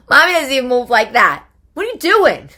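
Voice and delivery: exasperated yell. Retold or imitated. Mother yelling at her twerking daughter, exasperated high shout. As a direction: blow up in comic disbelief.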